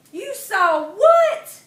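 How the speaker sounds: high, loud, slow disbelief; shocked. Acted. Mama hearing her son's tale, shocked.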